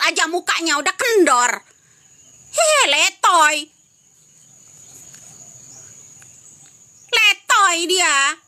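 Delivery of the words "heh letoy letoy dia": slow, drawn-out mocking jeer; scornful drawn-out jeer. Real, not acted. Streamer jeering at Pina's sister over livestream, slow scornful taunt. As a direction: stretch each syllable out in contempt.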